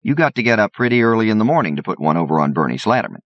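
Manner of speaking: triumphant. Acted. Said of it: "says Mister Slatterman triumphantly".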